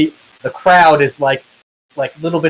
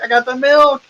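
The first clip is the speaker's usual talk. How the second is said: high-pitched triumphant crowd call; hype-man announcer; 12 semitones higher than usual. Acted. GM voices milkman hyping crowd.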